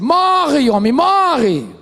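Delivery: high, loud cry with a long drawn-out "morre"; Job's wife shouting at her ruined husband; wife's shout. Retold or imitated. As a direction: yell it at him in scorn. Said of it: "a mulher dele disse assim".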